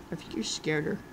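ordinary talk

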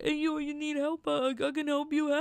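hammed-up impression breaking into a laugh; Tony Danza. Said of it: "Where he's like".

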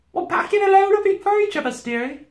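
very high, homely housewife voice; Missus Beaver. A narrator's reading. Beaver wife explaining to the girl, cool and high. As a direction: answer unbothered, in a high voice.

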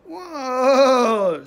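sudden loud startled cry; startled whoa. Real, not acted. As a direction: caught off guard by a jolt. Sudden jolt while filming.

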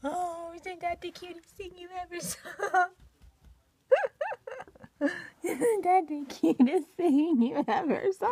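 high, loud cooing pet voice; baby talk to pets. Acted. Owner greeting her rabbits in a high cooing voice. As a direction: coo up high at the bunnies.

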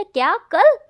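loud, high shocked cry. Acted. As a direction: blurt it out in disbelief. Shocked friend hears wedding tomorrow. (चौंककर ऊँची आवाज़ में)

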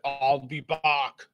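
put-on very slow, heavy Austrian accent, ɔl pi pɑk; exaggerated Arnold Schwarzenegger. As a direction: overdo the Austrian movie accent. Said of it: "we exaggerate that".

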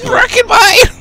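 high, loud mock British accent; mimicking a bad British accent. Echoing his friend's joke in a squeaky British accent. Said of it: "you'll do a British impression".

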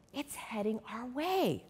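soft, worried realization; worried hush. Acted. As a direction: murmur it, fearing the storm. Freddie sees the storm's path.

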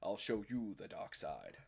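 low, slow, soft villain voice; Darth Vader. Pretend. Host parodying a helmet fetish, low villain voice. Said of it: "Darth Vader, but with bad accents".

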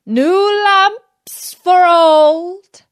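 slow, high, loud peddler's cry. Acted. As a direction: call it out to the street slowly.

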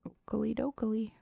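goofy okey dokey. Pretend. Playful reply voiced.

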